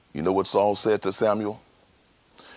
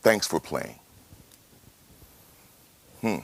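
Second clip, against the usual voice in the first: ordinary delivery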